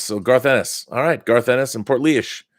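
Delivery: ordinary delivery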